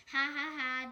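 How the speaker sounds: loud fake ha ha ha; mocking laugh. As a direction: laugh at the song to scorn it. Sonic laughs at the song.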